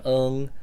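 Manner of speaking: "ung" with a nasal NG ending; pinyin ENG. Shown as a demonstration. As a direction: hold the "ung" in the nose. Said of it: "that E N G sound".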